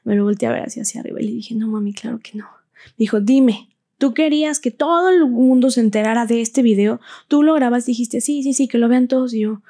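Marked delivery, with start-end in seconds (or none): 1.5-2.4 whispered denial. Retold or imitated. Answering mother in tears. 3.2-9.3 confronting quote. Retold or imitated. Mother confronting daughter sharply.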